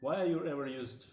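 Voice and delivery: ordinary talk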